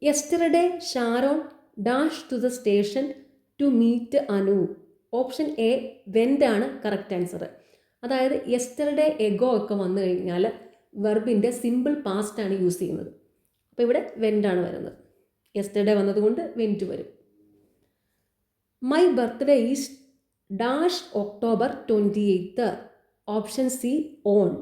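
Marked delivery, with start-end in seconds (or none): 0.0-4.6 slow question reading. Shown as a demonstration. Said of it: "reads the quiz question aloud". 18.8-22.6 slow question reading. Shown as a demonstration. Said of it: "reads the quiz question aloud".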